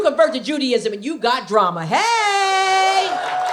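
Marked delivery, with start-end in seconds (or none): 1.8-3.5 shouted hey. Acted. Exclaiming at the drama.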